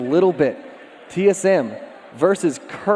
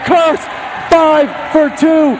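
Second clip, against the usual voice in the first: play-by-play; 11 semitones higher, 6 dB louder than usual. Acted. Live esports play-by-play casting.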